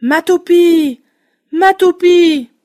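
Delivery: cri lent et fort, plus aigu; hurlement. A narrator's reading. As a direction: hurler vers le fond du puits. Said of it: "et hurla". (garçon qui hurle dans le puits)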